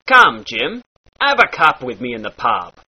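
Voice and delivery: "cup" and "pub" with a short ah vowel; pirate ah. Shown as a demonstration. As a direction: open the u of "pub" into ah. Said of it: "short ah sound".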